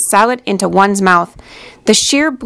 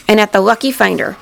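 usual speaking voice

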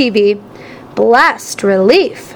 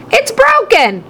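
exclaimed; 9 semitones higher, slower than usual. A narrator's reading. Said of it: "he exclaimed".

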